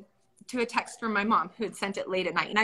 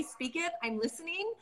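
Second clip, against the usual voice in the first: soft and a little higher, like a quiet prayer; hushed, listening in awe; softly offering herself to listen; hushed reverence; 7 semitones higher, 9 dB quieter than usual. Retold or imitated. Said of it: "I'm like".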